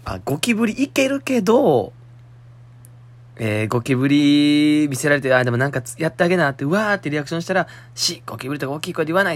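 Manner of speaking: high, loud lively retelling; animated retelling. Retold or imitated. Host excitedly retelling the listener's story. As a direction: replay her story with gusto.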